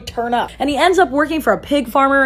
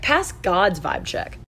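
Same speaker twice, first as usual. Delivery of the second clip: ordinary talk, nothing marked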